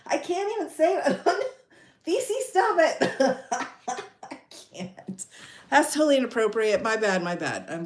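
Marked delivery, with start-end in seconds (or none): 0.1-5.3 high, squeaky words dissolving into laughs; real laughing fit mid-speech; overcome with laughter. Real, not acted. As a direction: give up helplessly as the giggles take over.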